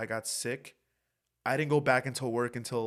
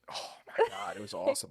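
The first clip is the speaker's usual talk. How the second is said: breathy awe; faster than usual. Real, not acted. Relishing getting his taste back.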